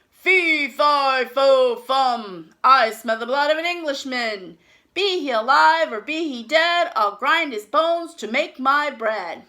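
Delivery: loud, booming giant chant; hammed-up menacing giant; giant's roar. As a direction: roar it like a big hungry giant.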